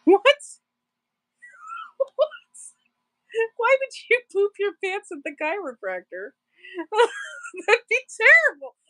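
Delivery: high-pitched, laughing disbelief; real cracking up. Real, not acted. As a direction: blurt it out through helpless laughter. Reacts to chat joke, cracking up.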